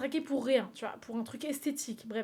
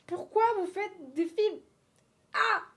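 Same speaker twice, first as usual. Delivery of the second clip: dramatic outrage; 9 semitones higher, 5 dB louder, slower than usual. Acted. Mock outrage at films.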